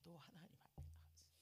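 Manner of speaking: fast hushed whisper. Real, not acted. Confessing to the congregation, whispering. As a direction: drop to a confiding whisper.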